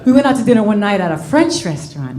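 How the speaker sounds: ordinary delivery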